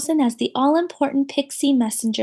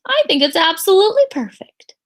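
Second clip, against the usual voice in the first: higher, louder glowing praise; admiring teacher; 7 semitones higher, 6 dB louder than usual. Acted. Teacher praising the name, glowing. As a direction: gush over it.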